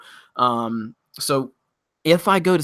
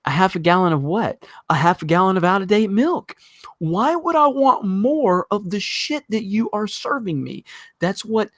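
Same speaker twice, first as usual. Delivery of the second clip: getting more worked up with every line; rising outrage; 7 semitones higher than usual. Real, not acted. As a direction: pitch climbing higher into an incredulous rant. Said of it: "outraged rant at the suggestion".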